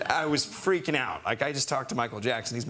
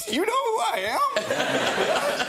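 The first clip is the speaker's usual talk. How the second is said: laughing sob; 4 dB louder, much slower than usual. Retold or imitated. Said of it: "I was like".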